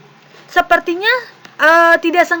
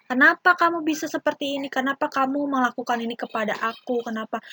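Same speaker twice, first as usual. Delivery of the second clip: patient, softer questioning; calm asking; 8 dB quieter than usual. Shown as a demonstration. Teacher shows how to ask calmly about a problem, softly. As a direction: ask gently without blaming, fading softer. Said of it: "kita harus tenang dulu, harus sabar".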